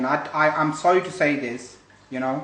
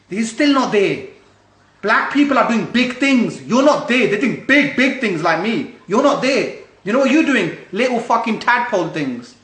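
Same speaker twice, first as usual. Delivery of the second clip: raised, heated put-down, hitting hard; louder and higher, angrily scolding them; real anger, voice raised; angry rant; 6 semitones higher, 6 dB louder than usual. Real, not acted. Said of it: "it makes me angry".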